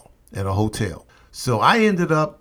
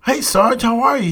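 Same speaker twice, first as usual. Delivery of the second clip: eager greeting; 11 semitones higher, 3 dB louder than usual. Retold or imitated. Greeting recruiter, nervous.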